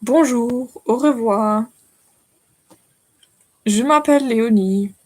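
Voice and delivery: French accent, slow beginner's phrases; halting French accent, phrase by phrase. Shown as a demonstration. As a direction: recite basic French with a careful French accent. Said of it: "my French accent is excellent".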